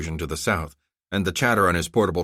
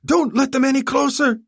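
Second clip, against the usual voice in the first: much higher, louder yelled order; yelling; 6 dB louder than usual. Acted. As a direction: yell it at the aide. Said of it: "he yelled to his aide".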